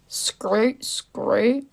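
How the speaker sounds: rough Aussie accent on a practice word; Australian accent. Shown as a demonstration. Streamer getting into character, Australian accent attempt. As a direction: try out an Australian vowel. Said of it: "That's how I get into my Australian accent".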